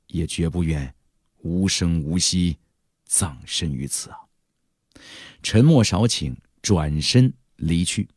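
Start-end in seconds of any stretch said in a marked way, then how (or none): 0.1-4.2 hushed vow. Acted. Hero's vow ends in hushed dread.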